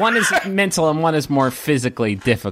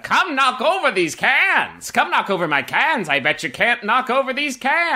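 carnival barker's yell; 8 semitones higher than usual. Acted. Enticing vendor hawking the can game, high barker's call. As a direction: call out the challenge like a fairground vendor. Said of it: "yells out".

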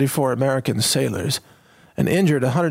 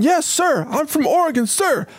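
mock dim sailor; 10 semitones higher than usual. Mock sailor answering officer.

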